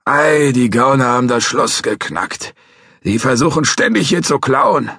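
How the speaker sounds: put-on a cranky old guard threatening to call police; old watchman.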